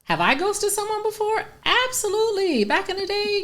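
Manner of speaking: high incredulous echo. Real, not acted. Surprised echo, high pitch.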